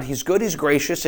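ordinary delivery

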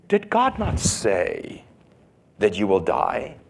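slow, insinuating serpent; drawn-out questioning tempter; sly Satan. Retold or imitated. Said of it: "what Satan did in the garden to Eve".

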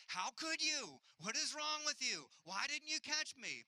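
high, loud indignant complaining; mock outrage. Father dusting himself off, blaming his son in mock outrage. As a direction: ham up the wounded indignation.

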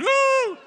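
high loud whoop; crowd-prompting whoop. Shown as a demonstration. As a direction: lead the crowd in a woo. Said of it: "Come on, come say it".

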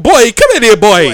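hammed-up island mama scolding; mock Jamaican mom. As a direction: holler him back to the table, half laughing. Acting Jamaican mom calling kid.

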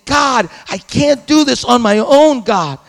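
loud pleading prayer to God; anguished prayer. Pretend. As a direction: pour out his anger to God in pain. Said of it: "he's probably saying".

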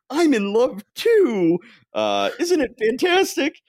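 high, swooning falsetto; giddy lovestruck Thor. Retold or imitated. Lovestruck Thor swoons to couples in the park. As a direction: gush it out like a smitten hero. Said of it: "talks to lovers in the park saying".

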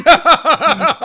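high loud burst of laughter. Real, not acted. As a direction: cackle loudly.

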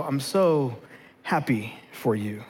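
single friend faking joy over a wedding invite; bitter fake happiness. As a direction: mumble it like you can barely get it out.

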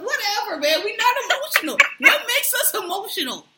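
high, giggling protest through laughter; laughing. Real, not acted. Laughing back at her friend's teasing about Cancers.

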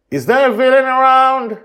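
put-on high, loud child's call. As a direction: shout up to the sky. Joy calls up to watcher.

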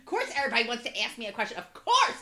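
loud, mocking exasperation; sarcastic rant. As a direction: fling it out sarcastically. Mocking her own rage.

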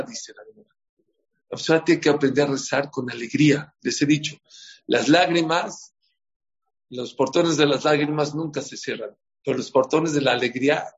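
ordinary delivery

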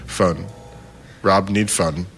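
slow, halting caveman phrasing; slow halting broken-language speech; broken Spanish. Retold or imitated. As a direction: chop it into slow broken words. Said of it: "I was speaking in super broken Spanish".

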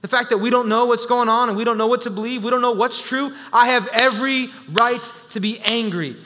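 loud, high, driving preacher's voice; full-force preaching. Acted. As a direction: preach it hard from the pulpit. Preacher's fired-up opening.

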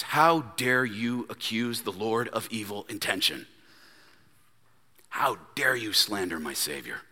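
low, hushed rebuke; quiet indignation. Acted. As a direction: rebuke him softly and gravely. Acts out prophet's indignant rebuke.

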